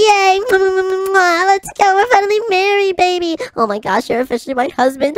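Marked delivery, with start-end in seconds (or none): 0.0-3.4 high, giddy newlywed cheer; bubbly bride gushing; overjoyed squeal. Acted. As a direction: squeal with delight at the wedding. Bride celebrating the wedding.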